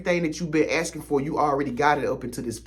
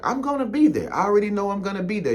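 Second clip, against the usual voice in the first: high, bright-eyed "I'm gonna be there", quicker on the second line; pitched-up giddy certainty, sure of the trip; excited certainty; 7 semitones higher, faster than usual. Pretend. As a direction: say it like it's already booked. Imagined confident inner voice.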